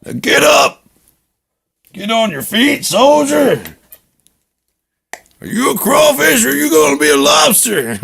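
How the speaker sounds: high, loud barked orders; drill sergeant shout. Acted. Drill sergeant ordering a soldier to his feet, bellowing. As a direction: bark at the recruit to stand.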